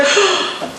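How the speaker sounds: high, sharp gasp of alarm; mock horrified gasp. Wife hearing her husband's sailboat dream, with a high gasp. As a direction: gasp like the plan is a disaster. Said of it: "you're just like".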